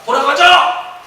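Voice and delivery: loud high command to leave; angry shout. Retold or imitated. General ordering his men home in a furious shout. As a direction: bark out the order to turn back. Naaman storms off.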